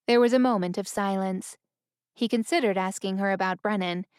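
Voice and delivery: ordinary delivery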